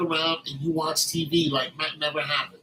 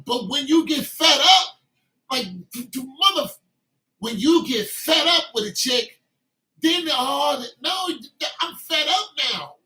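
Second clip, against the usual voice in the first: high, loud hurt indignation; a high, loud wounded rant, loud at the start; fed-up outburst; 6 semitones higher, 3 dB louder than usual. Acted. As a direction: play the man who's had enough. Acting the fed-up man.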